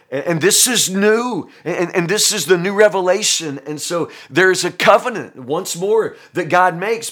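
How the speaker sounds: high, loud, fast revival preaching; full-force preaching. Acted. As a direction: preach it out to the congregation with fire.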